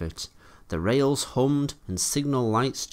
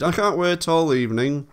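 high, louder complaint; impatient engine, voice raised; impatient interruption; 8 semitones higher, 5 dB louder than usual. A narrator's reading. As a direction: press impatiently for the news. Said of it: "Thomas interrupted".

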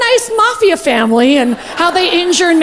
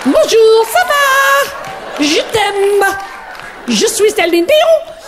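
high, drawn-out French-Canadian diva; sing-song French greeting in a high put-on voice; 6 semitones higher, much slower than usual. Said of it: "The husband of Canadian songbird Celine Dion".

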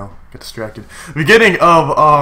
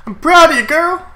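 much higher, louder pet-praise voice; cutesy praise; 6 dB louder than usual. Real, not acted. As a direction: gush over the Pokemon like a pet. Praising his Pokemon.

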